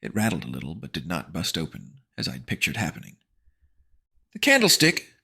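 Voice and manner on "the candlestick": put-on high, louder girl voice; alarmed girl; girl voice aloud. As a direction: blurt it out. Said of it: "Vampy said aloud".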